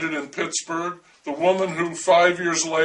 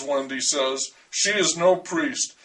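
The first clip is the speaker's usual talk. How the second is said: ordinary delivery, nothing marked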